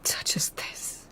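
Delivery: breathy near-whisper; almost afraid to say it; hushed confession. Acted. Shunnigan half-confessing her bond with Yukinari, hushed.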